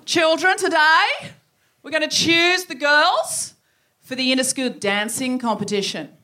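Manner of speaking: loud, high-pitched teacher addressing a class; Miss Power. Acted. Teacher announcing the dance selection to her class, commanding. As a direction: project like a schoolteacher making a big announcement. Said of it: "Miss Power stood up again in front".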